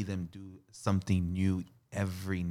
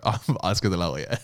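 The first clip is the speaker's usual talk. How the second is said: mock boast; 8 dB louder than usual. Mocking the pickup line, laughing.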